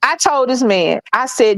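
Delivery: ordinary talk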